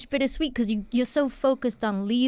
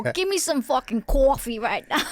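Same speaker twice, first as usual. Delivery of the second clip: put-on pushy, higher New York accent; 4 semitones higher than usual. Acting for Hollywood on "action", hammed-up New York accent. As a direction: play a demanding New Yorker with the accent. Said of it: "You wanna act".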